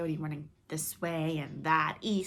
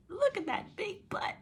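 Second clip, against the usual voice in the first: whispered snicker, high laughs. Acted. Coyote snickering at the big butt, whispered. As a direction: snicker it under your breath. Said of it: "Coyote was only snickering".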